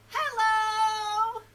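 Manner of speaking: big, high, drawn-out shout of hello; loud. Shown as a demonstration. Storytime host leads children in the loudest hello, shouting. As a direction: belt it out as loud as you can. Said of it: "Let's say hello as loud as we can".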